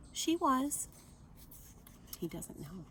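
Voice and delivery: ordinary delivery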